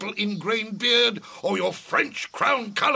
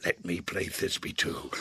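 ordinary talk

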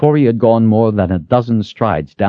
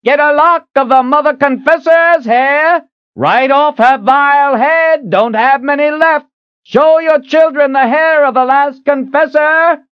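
bellowed; 6 dB louder than usual. A narrator's reading. Street hawker bellows to passersby, loud and high. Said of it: "the man bellowed".

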